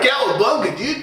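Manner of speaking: high, loud surfer cheer; Mikey surfer voice. Acted. Friend answering how Mikey talks, loud surfer cheer. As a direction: shout it like a surfer turtle. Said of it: "How does Mikey talk like that?".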